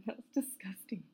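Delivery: whispered, embarrassed aside. Real, not acted. Excusing herself to Caesar in a whisper after burping. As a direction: breathe it out after a burp.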